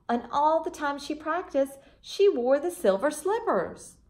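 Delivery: gentle storybook reading voice; children's storybook reader; story narration. A narrator's reading. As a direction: read it like a bedtime story to kids.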